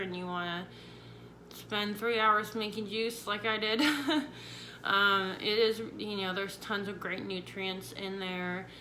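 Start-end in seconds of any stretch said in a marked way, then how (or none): 3.9-4.8 quick amused laugh; a brief laugh at herself; short laugh. Real, not acted. Laughs at her own joke.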